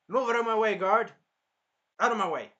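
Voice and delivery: high, loud order; imitating a hero storming out; commanding shout. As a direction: shove past the guards with it. Hero pushes past guards.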